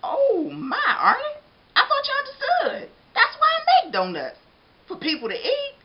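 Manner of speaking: high, chuckling baker voice; amused, kindly baker; laughing baker. Acted.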